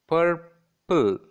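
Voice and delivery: two strong separate beats in "purple"; syllable by syllable. Shown as a demonstration. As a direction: leave a clap-sized gap inside "purple". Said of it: "clap in between the syllables".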